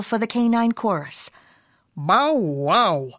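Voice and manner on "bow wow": lower-pitched swooping dog woof. A narrator's reading. Music teacher dog answers the singer, woofing low. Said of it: "woofed Mister Muzzletuff".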